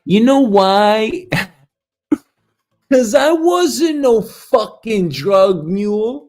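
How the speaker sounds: loud, high, drawn-out gloating; elated relief. Acted. Man realising he was never a drug mule, elated gloating. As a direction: crow it slowly, laughing between phrases.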